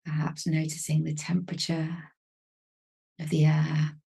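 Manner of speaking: calm, unhurried meditation voice with long pauses; even, measured delivery, leaving space to breathe; guided meditation. Real, not acted. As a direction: gently guide them into stillness, phrase by phrase.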